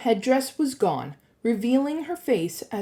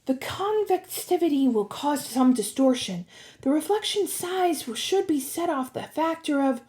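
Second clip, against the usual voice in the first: put-on higher girl's voice, lecturing; lifted, studious tone; critical young expert; 7 semitones higher than usual. A narrator's reading. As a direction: reel off technical analysis. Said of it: "She studied the sphere critically".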